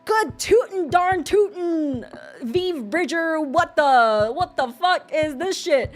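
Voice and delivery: slow, drawn-out mock old-timer; hammed-up old boomer. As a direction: play a flabbergasted old-timer, slowly. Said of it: "I was like".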